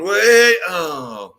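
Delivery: high, loud hopeful squeal dropping to disappointment; excited fan squeal. Retold or imitated. Fans at stage door squealing then deflating. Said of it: "all these girls going".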